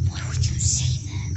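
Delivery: unvoiced whisper. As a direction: whisper it to yourself. Scolding self after bad word.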